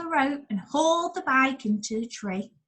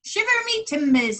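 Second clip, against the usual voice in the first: pirate exclamation; 11 semitones higher, 4 dB louder than usual. Acted. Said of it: "he exclaimed".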